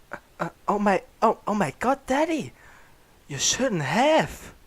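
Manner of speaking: high, loud hammed-up squeal of delight; excited yell. Son seeing the room of gifts, squealing excitedly. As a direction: squeal it out, overjoyed. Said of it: "I yelled in excitement".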